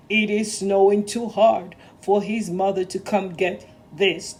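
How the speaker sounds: stern boss. Acted. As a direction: speak as the firm railway boss giving an order. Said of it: "said Sir Topham Hatt".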